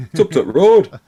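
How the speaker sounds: high, loud hammed-up Barnsley accent; Yorkshire accent. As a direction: call it out in broad mock Yorkshire. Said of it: "killing that accent there".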